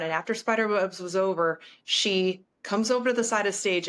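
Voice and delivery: ordinary talk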